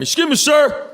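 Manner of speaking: high, strained call from a stranger; mock yell across the gas pumps. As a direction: imitate a man hollering at a gas station. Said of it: "he starts yelling at me".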